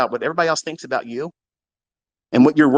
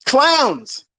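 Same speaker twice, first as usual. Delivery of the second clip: jeering shout; 10 semitones higher than usual. Real, not acted. Trader yelling at his critics, high and scornful. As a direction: sneer it out at the critics.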